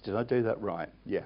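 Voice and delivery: ordinary talk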